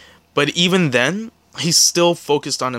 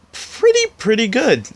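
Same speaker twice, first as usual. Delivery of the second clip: high squeak; 11 semitones higher than usual. Real, not acted. Self-correcting emphasis.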